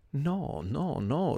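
anguished, rising no no no. A narrator's reading. Doctor denies the suspicion to Wickfield, anguished. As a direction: cry out the denial in distress. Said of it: "con voce angosciata".

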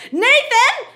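Newfoundland mother's high, loud scream; screamed name. Retold or imitated. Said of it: "a voice screamed".

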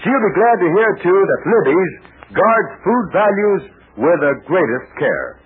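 upbeat radio commercial pitch; ad read. Acted. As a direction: sell it warmly to the kids. Sponsor ad read for children.

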